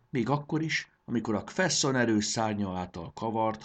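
plain reading voice

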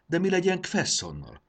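high-pitched anxious interjection; Módusz, nervous. A narrator's reading. Módusz interrupts the leader about their captive, nervously. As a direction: cut in nervously, voice jumping up. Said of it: "Hangja idegesen remegett".

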